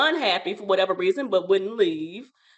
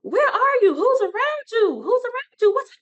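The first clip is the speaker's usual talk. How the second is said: high, loud, frantic mother; a worried mother half-shouting down the line; frantic mom; 12 semitones higher, 4 dB louder than usual. Retold or imitated. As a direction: play the panicked mom on the phone. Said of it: "she's like".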